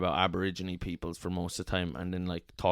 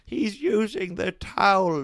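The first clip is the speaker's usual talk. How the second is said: mock crying; 10 semitones higher, 5 dB louder, slower than usual. Mother crying through the door.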